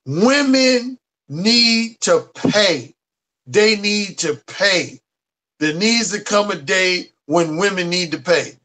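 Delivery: slow, heavy, deliberate words; emphatic quote. Retold or imitated. Quoting the brother's statement, slow and emphatic. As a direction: lean hard on each word like a threat. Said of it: "When you saying that emphatically".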